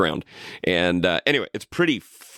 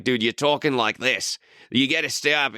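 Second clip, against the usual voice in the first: lightly imitated Australian accent. Teasing an actor who can't hide his accent, mock Australian accent. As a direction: slip a hint of Australian into it. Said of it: "he cannot, like, hide his accent".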